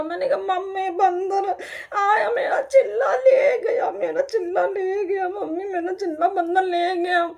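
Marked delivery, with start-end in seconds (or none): 0.4-2.3 high, slow tearful wail; crying complaint. Retold or imitated. Child crying to her mother, high and slow. 2.3-3.9 high, loud, slow sobbing shout; crying shout. Retold or imitated. Child howling to her mother about her lost food, high and loud. 3.9-7.3 tearful whimper. Retold or imitated. Crying to mother.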